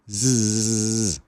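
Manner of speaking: a long voiced buzz, zzz; buzzing z sound. Shown as a demonstration. Teacher demonstrating the S sound as a buzzing z. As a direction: hold the buzzing Zzz like a bee. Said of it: "Esse som do S é um som de zzz mesmo".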